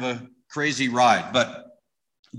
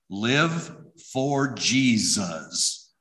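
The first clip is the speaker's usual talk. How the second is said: "Live for Jesus" drawn out very slowly, each word stretched; reading the plate slowly; much slower than usual. Shown as a demonstration. Telling the audience what the plate said, very slow and weighted. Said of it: "his license plate said this".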